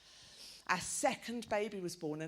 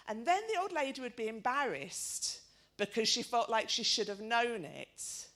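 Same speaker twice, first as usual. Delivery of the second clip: ordinary talk, nothing marked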